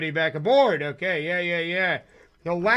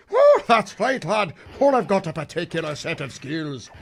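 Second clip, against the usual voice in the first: a hammed-up, deliberately terrible Irish brogue; bad Irish accent. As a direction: play a cheesy Irish tough guy threatening with a laugh. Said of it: "Worst Irish impression ever".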